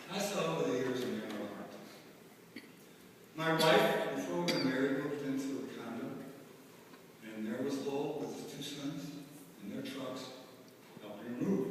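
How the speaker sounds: soft, tender remembrance; dignified grief. Real, not acted. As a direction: quietly recall a kind man. Tender memory in eulogy.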